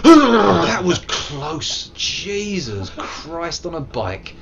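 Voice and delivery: very high gasp, loud, slow relief; real fright gasp. Real, not acted. Nearly dying in the game, high loud gasp then slow relief. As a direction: gasp then breathe out the near miss.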